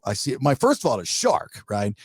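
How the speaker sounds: ordinary talk, nothing marked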